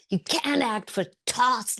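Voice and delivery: high-pitched scornful put-down; retold director's scolding; insulting tirade. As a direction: tear into an actress, acted out. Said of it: "laid into her and insulted her".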